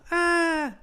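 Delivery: a falling man's panicked cry; high scared yelp. Shown as a demonstration. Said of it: "Your voice would go".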